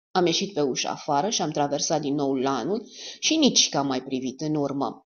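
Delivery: ordinary delivery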